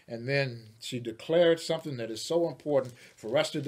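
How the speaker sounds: ordinary talk, nothing marked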